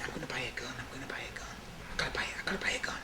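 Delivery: urgent whisper to himself; whispered thoughts. Retold or imitated. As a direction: whisper it, obsessed and scared. Reliving fear, whispered thoughts.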